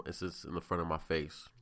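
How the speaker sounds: ordinary delivery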